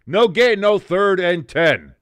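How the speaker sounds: sudden loud, high call of the down; loud play call. Real, not acted. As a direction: belt out the play result. Calling the play live.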